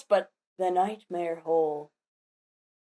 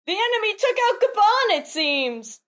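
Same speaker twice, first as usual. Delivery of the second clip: high, quick theatrical call-out; acted battle cry; 11 semitones higher than usual. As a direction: shout the report as a fleeing giant. Fleeing giants yelling in manga reading.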